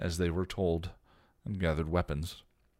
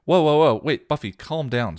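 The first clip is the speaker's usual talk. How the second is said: higher, louder pleading to calm down; trying to calm her; 10 semitones higher, 12 dB louder than usual. A narrator's reading. Xander tries calming furious Buffy, loud. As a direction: hold her back with your voice. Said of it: "Xander tried".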